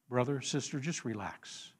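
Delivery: low, barely voiced comfort; hushed, calming aside; soothing. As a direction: ease their fear very softly. Voices Moses soothing the frightened.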